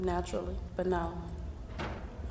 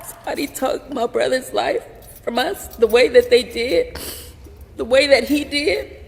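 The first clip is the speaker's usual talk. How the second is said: raised, high, hurt accusation; anguished anger; 5 semitones higher, 7 dB louder than usual. Real, not acted. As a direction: accuse through the hurt, getting louder. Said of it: "I'm angry".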